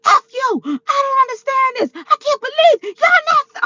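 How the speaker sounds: high, ranting mock shout; going off. Grandfather ranting about the gender reveal, mock shouting. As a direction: rage like a furious grandfather. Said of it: "the way he was going off".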